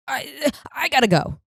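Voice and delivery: a hint of a flustered mom rushing off; panicky mom. Daughter imitating mom rushing off the phone, mock panic.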